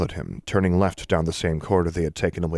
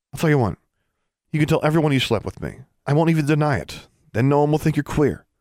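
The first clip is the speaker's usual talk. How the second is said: high, fast, cutting sarcasm; mock him with a high bitter offer; scornful offer; 9 semitones higher, faster than usual. A narrator's reading. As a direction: Alexandra sneering at Torvald's fear of rumours. Alexandra's scornful offer to Torvald.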